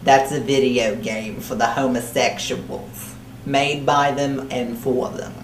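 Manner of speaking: thick put-on New Jersey accent, held all the way. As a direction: talk like a crude New Jersey broad throughout. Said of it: "I don't know where the accent came from".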